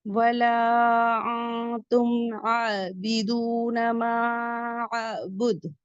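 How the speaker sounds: slow melodic recitation; Quran recitation. Shown as a demonstration. Teacher recites the verse for the class, slow and melodic. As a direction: chant the verse slowly. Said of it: "recites the verse".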